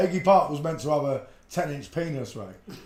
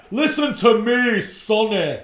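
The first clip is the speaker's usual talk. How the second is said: mock stern Scottish woman, much higher and louder, slow; bossy Dolores; 7 semitones higher, 8 dB louder, slower than usual. Imitating Dolores from the live chat, slow mock-stern. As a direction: talk down to him like a scolding auntie. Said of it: "She was like".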